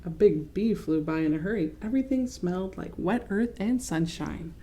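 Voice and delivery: usual speaking voice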